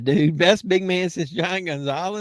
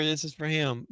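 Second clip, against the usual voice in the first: ordinary talk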